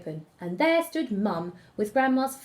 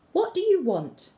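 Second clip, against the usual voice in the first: put-on high, bold girl's question, louder; bold little girl; 9 semitones higher, 4 dB louder than usual. A narrator's reading. Girl boldly asks the stranger in the woods, high and bold. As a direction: ask it boldly, a small girl standing her ground.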